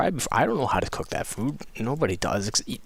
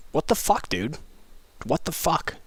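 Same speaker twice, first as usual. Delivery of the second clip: higher-pitched, incredulous rebuke; retold outrage in a raised pitch; indignant; 6 semitones higher than usual. Retells indignant reply to racist meme.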